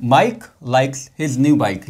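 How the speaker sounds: very slow, word by word; slow example read. Shown as a demonstration. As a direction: read each word slowly for the class. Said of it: "Same ways, examples यहाँ पे". (धीरे-धीरे पढ़ा गया उदाहरण)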